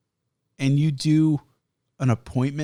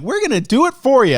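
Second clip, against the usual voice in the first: perky robot helper; 9 semitones higher, 8 dB louder than usual. Retold or imitated. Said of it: "they're like".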